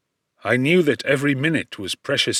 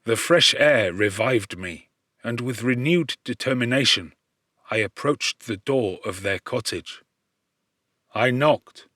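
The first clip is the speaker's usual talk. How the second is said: usual speaking voice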